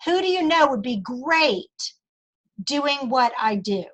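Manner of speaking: "Who" stressed, slow and falling; slow deliberate ask, "Who" leaned on; slow, pointed question. Shown as a demonstration. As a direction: lean on "Who" and take it slowly. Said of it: "Listen to how I say that".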